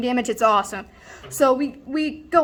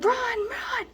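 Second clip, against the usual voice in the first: very high, hushed-down mock yell; panicked urging; 11 semitones higher, 6 dB quieter than usual. Storyteller to friend fleeing police, in squealed panic. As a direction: squeal it as a held-back scream.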